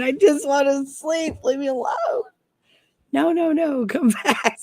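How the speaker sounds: comically desperate high voice; mock pleading. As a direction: whine for mercy, half laughing. Acts out pleading in the nightmare.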